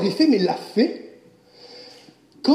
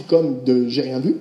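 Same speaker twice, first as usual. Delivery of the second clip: feigning innocence; 9 semitones lower than usual. Retold or imitated. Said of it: "je fais style que je les ai pas vus".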